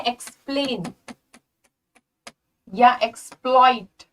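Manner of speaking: एक्सप्लेन and एक्सप्लॉइट with a clear "ks"; careful model words, unvoiced k-s in एक्सप्लॉइट; audible x sound. Shown as a demonstration. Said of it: "यहाँ पे एक्स की आवाज आती है".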